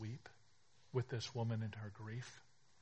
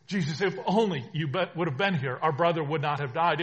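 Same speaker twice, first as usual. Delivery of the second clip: loud anguished plea, pitch pushed up; louder, anguished first take of the plea; pleading sisters; 5 semitones higher, 12 dB louder than usual. Acted. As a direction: cry it out as the grieving sisters. Grieving sisters reproach Jesus.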